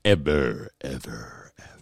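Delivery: ever repeated and fading like an echo. Shown as a demonstration. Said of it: "I wish I had, like, an echo thing".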